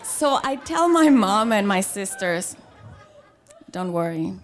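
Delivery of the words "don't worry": low-key, softer swagger; cool confidence. Retold or imitated. Woman reassuring her mom and sisters, coolly confident. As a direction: drop it low and sure, trailing softer. Tells family she will handle it.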